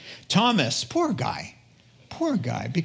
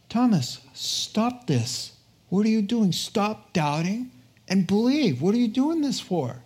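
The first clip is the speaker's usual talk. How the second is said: mild rebuke; 7 semitones higher than usual. Acted. Jesus chiding the doubter.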